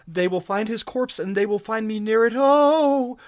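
high-pitched, urgent woman's voice; terrified moaning plea. A narrator's reading. Captive explaining herself to her rescuer, terrified. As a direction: plead high, ending in a loud moaned cry. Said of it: "she moaned in her terror".

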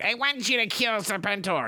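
high, hammed-up villain command. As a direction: order it like a cartoon villain. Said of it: "Cobra Commander's like".

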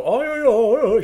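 high, loud jungle yodel; Tarzan call. Retold or imitated. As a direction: hoot it like Tarzan. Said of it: "She'd do Tarzan things".

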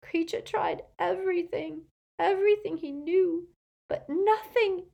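high, anguished elf lament; moaning elf. A narrator's reading. Elf confesses his failed attempts, high anguished lament. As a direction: wail through the failures, building in despair. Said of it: "moaned the elf".

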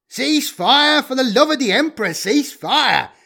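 loud, urgent shout to stop the shooting; shouted order. A narrator's reading. Magritte yelling at his men to stop firing, loud shout. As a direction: bellow it across the battlefield. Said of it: "Magritte shouted".

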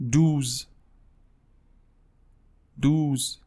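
دوز with a long ou and z; دوز as French douze. Shown as a demonstration. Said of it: "دوازده".

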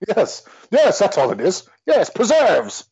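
hammed-up villain, swooping high; gloating culprit. Culprit confirms the murder weapon to inspector, gloating theatrically. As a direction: revel in it like a pantomime villain. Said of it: "our little mystery play".

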